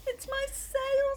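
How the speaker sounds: high slow goat voice. Acted. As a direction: bleat it out like a sad goat. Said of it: "said the goat".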